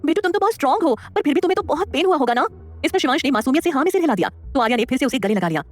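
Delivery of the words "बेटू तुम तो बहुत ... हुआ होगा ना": a mother soothing her injured son; tender. A narrator's reading. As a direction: comfort the hurt boy. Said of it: "प्यार से बोली".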